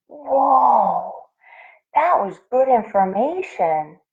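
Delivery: excited yelping. Acted. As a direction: burst out with a new idea. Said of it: "yelped Jitterbug".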